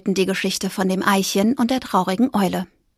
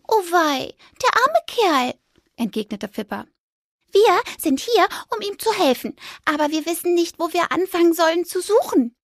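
higher, worried little mouse voice; a small mouse full of pity; sympathetic mouse; 8 semitones higher than usual. Acted. Fipper pities the grumpy magpie, high worried mouse voice.

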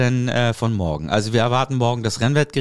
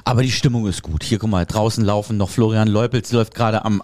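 usual speaking voice

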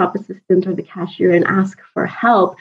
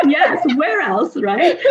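laughing; 6 semitones higher, 3 dB quieter, slower than usual. Real, not acted. Laughing at husband's nationality.

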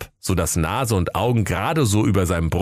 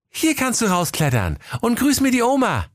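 put-on high boy voice. Pelle speaks.

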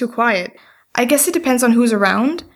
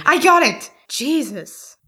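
exasperated; 4 semitones higher, slower than usual. Acted. Cuts off friend exasperated.

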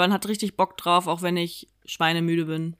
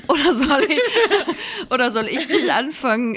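laughing; 5 semitones higher, 3 dB louder than usual. Real, not acted. Giggling through a question.

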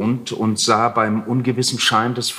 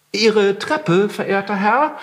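higher, innocent-sounding Heinrich; feigned innocence; 7 semitones higher than usual. A narrator's reading. Heinrich to the landlord, feigning surprise. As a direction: play dumb, all politeness. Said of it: "erwiderte Heinrich".

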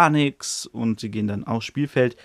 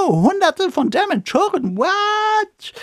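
much higher, startled shriek of disbelief; shocked; 3 dB louder, slower than usual. Retold or imitated. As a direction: gasp it out in alarm. Sees hundreds of Dementors below.

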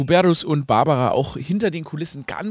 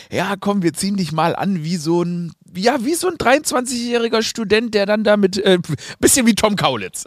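lightly voiced eager stylist, higher; mock stylist; 7 semitones higher than usual. Stylist pitching an outfit to the celebrity, in a mock-eager higher voice. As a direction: play a pushy stylist dressing up a client, a bit higher. Imagined stylist dressing him up.

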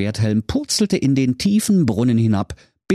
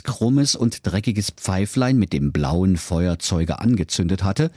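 plain reading voice

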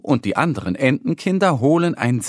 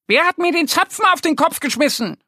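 high, fast, angry duck voice; squawking angry little duck; wild scolding; faster than usual. Acted. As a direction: scold whoever threw it. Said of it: "Sie schimpft und quakt ganz wild".

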